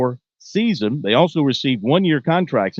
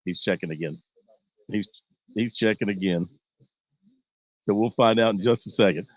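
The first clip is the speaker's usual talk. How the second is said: hushed; 5 semitones lower, 6 dB quieter than usual. Real, not acted. Guest sleeping, hushed update.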